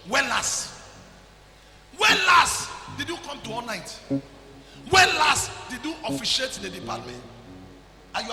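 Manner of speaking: loud, slow accusing questions; slow rebuke. Real, not acted. Preacher demanding when she last came to church, loud and slow. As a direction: drag out each reproach.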